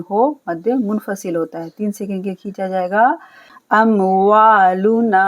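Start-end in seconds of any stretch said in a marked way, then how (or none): 3.7-5.2 अमवालुना slow and louder with वा drawn out; slow elongated वा; stretched wa. Shown as a demonstration. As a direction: hold the वा in अमवालुना. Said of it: "वाव अलिफ जबर वा को हम खींचेंगे".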